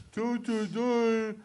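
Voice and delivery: high, drawn-out hammed-up wail; silly high-pitched slow whine; exaggerated mock cry. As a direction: stretch it out high in mock despair. Exaggerated repeat of his own line.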